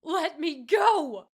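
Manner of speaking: very high, very loud, slow desperate shout; crying out. A narrator's reading. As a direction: fight the guards and the tears. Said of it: "the elf cried out as she fought back tears".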